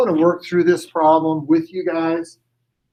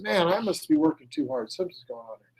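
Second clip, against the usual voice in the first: talking to himself; 9 dB quieter, faster than usual. Acted. Puzzled customer in the joke talks to himself, fading away. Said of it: "He goes".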